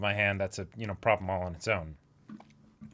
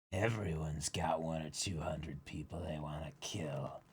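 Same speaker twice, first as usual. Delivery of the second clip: mother figure voice; 6 semitones lower, 11 dB quieter than usual. Acted. Voicing Big Mom, soft.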